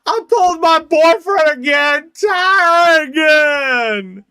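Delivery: high, loud, drawn-out shout of joy; loud high delighted cry; excited squeal. Real, not acted. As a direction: squeal the name like a fangirl. Excited pull reaction.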